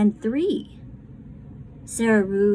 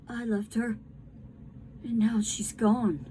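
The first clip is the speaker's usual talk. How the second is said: soft, whispered grief; quiet grief; 6 dB quieter than usual. Acted. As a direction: breathe the words in sorrow. Toy's silent grieving thought.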